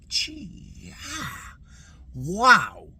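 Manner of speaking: high, soft "gee" rising into a drawn wow; awed reaction. Retold or imitated. Voter recalls seeing the specimens, awed. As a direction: breathe it out, stunned. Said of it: "and you're like".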